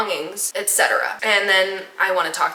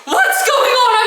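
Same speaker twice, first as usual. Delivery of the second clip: high, loud, stretched-out question; alarmed mock driver; 6 dB louder, slower than usual. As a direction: imitate a bewildered driver crying out.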